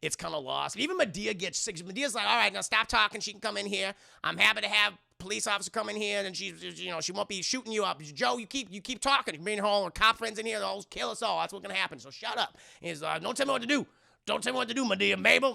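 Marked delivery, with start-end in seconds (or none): 2.3-12.6 high, loud scolding Madea voice; bossy old auntie telling him off. Retold or imitated. Madea telling Joe to shut up, high and loud scolding. 13.2-15.5 high, loud defiant shout back; angry retort. Retold or imitated. Joe yelling at Madea not to boss him, loud and high.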